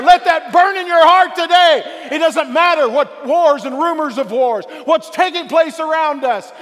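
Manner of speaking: loud, high, building sermon climax; preaching at full force. Real, not acted. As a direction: preach it out, rising and pounding.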